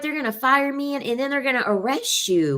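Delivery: high-pitched, mock-worried protest; mock warning. Acts out warning client.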